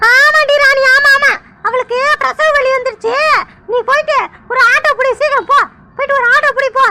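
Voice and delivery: a fussing grandmother rushing to help; worried grandmother. Acted. Grandmother voice again.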